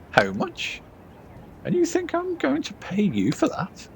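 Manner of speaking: you can't believe the nerve of this; indignant disbelief. Acted. Outraged client in game dialogue.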